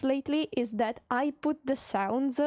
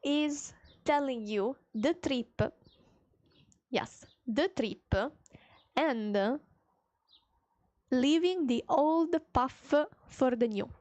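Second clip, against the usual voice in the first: usual speaking voice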